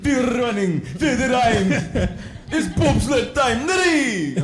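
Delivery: high, loud Jamaican movie quote; Jamaican accent. Acted. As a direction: belt it out like a hammed-up Jamaican bobsledder. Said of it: "Jamaican bobsled".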